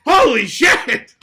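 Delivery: high, slow, booming cry of betrayal; mock shocked shout. Duped recruit realizing the scam, shocked shout. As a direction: yell it as a hammed-up revelation.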